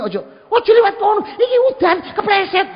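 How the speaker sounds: very high, loud, strident nagging; high, hurtful scolding. Shown as a demonstration. Child nagging a mother not to walk in the rain, high scolding. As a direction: scold at the top of the pitch range. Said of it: "Wih, nyenyek tun tak omongi".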